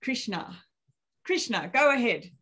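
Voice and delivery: ordinary delivery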